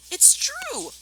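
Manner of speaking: put-on very high, loud childlike shout; young foxes insisting with delight; eager cry. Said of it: "cried the small foxes".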